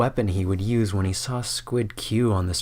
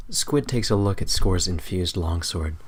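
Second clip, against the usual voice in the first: ordinary delivery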